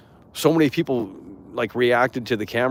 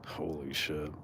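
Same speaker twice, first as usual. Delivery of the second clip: breathless whisper; a stunned whisper to himself; whispered disbelief; 5 semitones lower, 13 dB quieter, slower than usual. Real, not acted. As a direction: hush it in awe.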